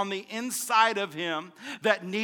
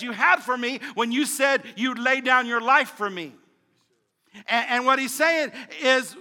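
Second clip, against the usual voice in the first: loud, higher, driving preacher voice; preaching at full force; 4 semitones higher, 5 dB louder than usual. Acted. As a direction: preach it up with fire.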